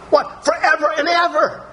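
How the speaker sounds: astonished high burst of awe; amazed exclaim. Real, not acted. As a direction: leap high in sheer wonder on forever and ever. Preacher marvels at David's vow.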